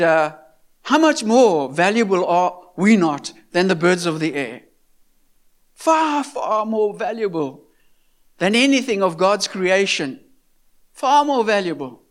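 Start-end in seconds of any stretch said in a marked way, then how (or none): none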